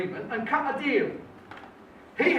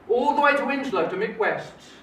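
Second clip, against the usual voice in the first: ordinary delivery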